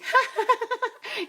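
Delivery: high, loud burst of laughter. Real, not acted. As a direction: burst out laughing at your own slip. Laughs at her own word slip.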